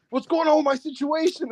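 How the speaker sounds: high-pitched imitation of an indignant wrestler; hammed-up grievance, pitched well up; mock promo complaint. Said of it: "and then, like".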